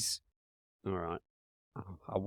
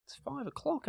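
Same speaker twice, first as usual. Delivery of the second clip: high mock indignant; 14 semitones higher, 8 dB quieter than usual. Real, not acted. Mock indignant about timing.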